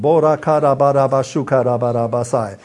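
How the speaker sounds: fluent, flowing glossolalia; praying in tongues. Real, not acted. Preacher praying in tongues before the congregation, flowing and fervent. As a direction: let the tongues pour out steadily.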